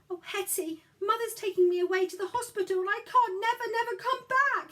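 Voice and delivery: put-on high, loud crying child's plea; a boy sobs out that he's being taken away; sobbing. A narrator's reading. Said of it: "he sobbed".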